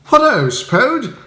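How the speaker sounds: high, bright upper-class chirp; cheery Bertie. Acted. Bertie greeting Spode at the door, high and breezy. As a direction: greet him breezily, unbothered.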